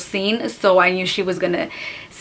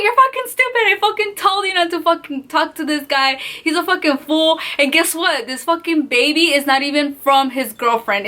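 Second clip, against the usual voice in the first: higher-pitched retold telling-off; scolding cousin; 6 semitones higher than usual. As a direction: imitate a cousin laying into you.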